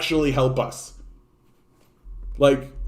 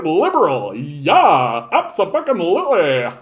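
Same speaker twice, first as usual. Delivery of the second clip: sneering impression of a clueless voter; mocking voter. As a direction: parody a cheerful clueless liberal voter. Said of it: "You're just like".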